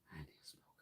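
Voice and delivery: barely voiced private whisper; a hushed whispered aside. Real, not acted. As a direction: breathe it out under your breath. Muttered aside off to the side.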